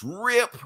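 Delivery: sharp, high onomatopoeic rip; high rip sound effect. Retold or imitated. Reader acting out the panel's ripping sound, high. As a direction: spit out the comic's sound effect.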